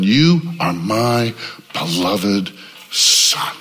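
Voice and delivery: slow, low, hushed divine voice; God's voice. Acted. God speaking from heaven over Jesus, slow and reverent.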